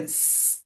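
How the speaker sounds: "S" as a soft, sustained hiss; little snake sound. Shown as a demonstration. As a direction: hold a voiceless sss. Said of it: "o som da cobrinha".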